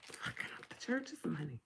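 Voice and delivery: breathy whispered aside; hushed secret aside. Real, not acted. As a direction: whisper it under your breath. Aside, dropped voice.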